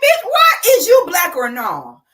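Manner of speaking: high, loud disbelieving bark; incredulous yell. Retold or imitated. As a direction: shout it like you can't believe her. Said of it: "And I'm sitting there like".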